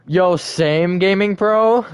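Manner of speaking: high, loud excited hello; excited greeting. Real, not acted. Streamer greets a chat viewer excitedly. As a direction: hype shout-out to a viewer.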